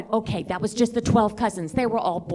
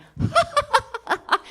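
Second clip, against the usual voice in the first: high-pitched imitated cackle; mocking policeman's laugh. Policeman laughing at her French, high mocking laugh. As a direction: laugh at someone in a high falsetto.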